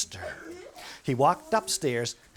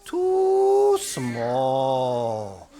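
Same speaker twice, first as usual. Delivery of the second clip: dismissive doctor; 5 semitones higher, 3 dB louder, much slower than usual. Acted. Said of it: "He said".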